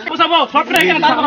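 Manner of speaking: loud, high commanding yell; angry full-voice command; shouted orders. Acted. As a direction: shout to line them all up. Orders the boys lined up.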